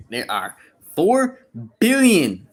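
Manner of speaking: slow, high and loud for emphasis; emphatic. Real, not acted. Telling a shy viewer there are plenty of women, slow and emphatic. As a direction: stretch out four billion to make the point.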